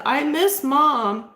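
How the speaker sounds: mimicking a ghost child crying for his mom; imitating crying boy. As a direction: voice a tearful little boy, lightly. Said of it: "and he's, like, crying".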